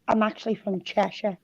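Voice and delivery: slightly posh English accent. Real, not acted. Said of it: "That sounded really posh".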